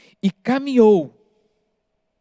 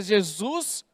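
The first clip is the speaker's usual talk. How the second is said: ordinary delivery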